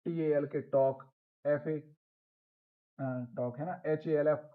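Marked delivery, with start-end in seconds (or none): 0.6-1.0 टॉक with no L before the K; silent L. Shown as a demonstration. Teacher reading a spelled example, silent L in टॉक. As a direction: say टॉक, leaving the L unsounded. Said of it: "silent हो गया है".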